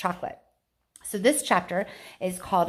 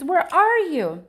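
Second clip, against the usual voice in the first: high, loud, slow desperate cry; screaming; 9 semitones higher, 8 dB louder, slower than usual. A narrator's reading. Mother cries out for her vanished son. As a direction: cry out into the room. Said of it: "screamed Missus T V".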